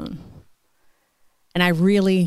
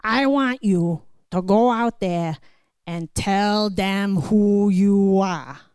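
slow, deliberate father's words; firm dad advice; slower than usual. Retold or imitated. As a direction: chop each word like a lesson. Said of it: "he says to me".

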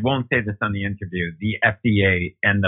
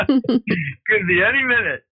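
wry echo; 9 semitones higher, slower than usual. Real, not acted. Echoing doctor's grim words, laughing.

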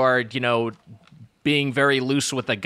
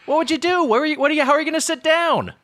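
incredulous; 12 semitones higher than usual. Real, not acted. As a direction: ask in high amazed disbelief. Incredulous about flat ass.

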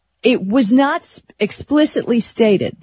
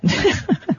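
amused laugh; 5 semitones higher than usual. Real, not acted. Laugh after sarcastic sign-off.

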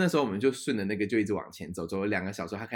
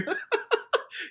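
high airy burst of laughter; high breathy laugh. Real, not acted. Recalling singing to a crying friend, airy high laugh.

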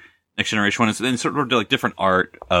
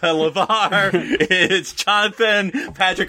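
laughing while talking; 8 semitones higher than usual. Real, not acted. Joking list, laughing through names.

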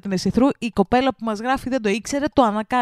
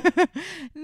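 high laughing burst; burst of laughter; 9 semitones higher than usual. Real, not acted. Laughing at the co-host's odd voice, high burst of laughter. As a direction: crack up at the voice.

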